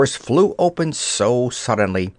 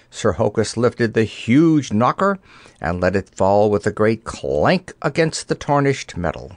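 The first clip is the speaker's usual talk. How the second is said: plain reading voice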